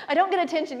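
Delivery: ordinary talk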